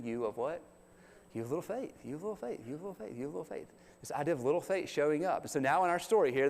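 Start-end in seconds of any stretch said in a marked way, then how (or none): 0.0-3.7 softer and softer, speeding up at the end; Jesus repeating rebuke. Retold or imitated. Jesus keeps telling them they have little faith, fading away. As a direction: let the rebuke fade to a murmur. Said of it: "what does he say then".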